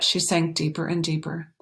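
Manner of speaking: plain reading voice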